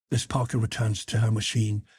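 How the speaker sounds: usual speaking voice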